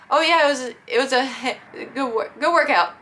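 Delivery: high, loud, stumbling friendliness; flustered reply. Retold or imitated. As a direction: stammer it out with an awkward laugh.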